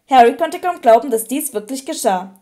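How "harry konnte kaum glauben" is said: ordinary delivery, nothing marked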